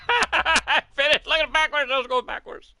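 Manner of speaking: loud, high, excited shouts through laughter; laughing triumph. Real, not acted. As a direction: crow with delight while cracking up. Crosses finish line backwards.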